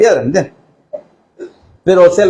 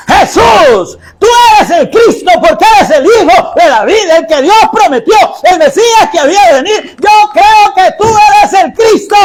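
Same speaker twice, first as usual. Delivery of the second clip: higher, a little louder declaration of faith; fervent shout; 9 semitones higher, 3 dB louder than usual. Retold or imitated. Blind man shouting his faith to Jesus, higher and louder. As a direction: cry it out as a bold confession. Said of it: "Ese hombre gritaba".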